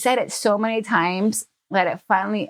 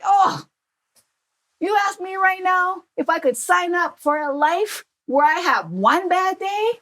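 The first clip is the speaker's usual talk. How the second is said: excited dad; 9 semitones higher than usual. Retold or imitated. Dad slapping his knee at his daughter, excited and higher. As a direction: sell it like the best deal ever. Dad's excited rhetorical question.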